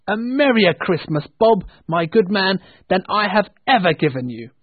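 elated boss, ringing with cheer; jubilant goodwill. A narrator's reading. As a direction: pour out generous good news. Scrooge joyfully promises Bob help.

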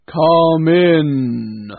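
loud, echoing spirit voice; booming ghost voice. A narrator's reading. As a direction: call out like a giant spirit. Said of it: "said the ghost".

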